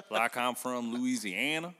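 slow put-on Louisiana drawl; Southern drawl. Shown as a demonstration. Host demonstrating his Southern accent, slow Louisiana drawl. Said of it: "I can do a Southern drawl".

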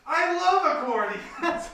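high, loud, drawn-out gushing shout; yelling up. Acted. Greta tells Tang of loving accordions, delighted long yell. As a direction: shout your delight across the water. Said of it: "a voice yells up from the boat".

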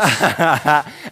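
short amused chuckle; a quick laugh to himself. Real, not acted. Laughs about Lo the powerhouse.

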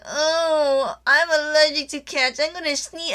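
put-on high-pitched girly voice; airy high ditzy tone. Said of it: "if she's like".

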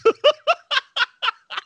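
squealing, helpless laughter; real high laugh at the memory; high-pitched laugh. Real, not acted. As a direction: crack up high and breathless. Cracking up at the story.

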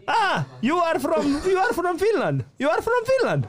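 very high, slow imitated officer realizing; hammed-up foreign officer finally getting it; mocking the police officer. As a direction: draw it out high like a surprised official. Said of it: "Sit ne kattoo vaan tälleen".

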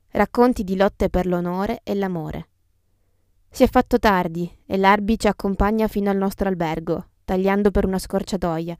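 ordinary delivery

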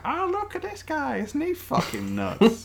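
high, hammed-up showman voice, slowing at the end; mock showman. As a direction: present him like a freak-show barker. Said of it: "and be like".

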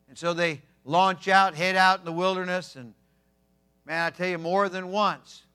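normal talking voice

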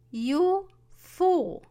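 loud, high angry scolding; angry mother. A narrator's reading. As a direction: snap it at him furiously. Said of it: "Jack's mother was very angry".

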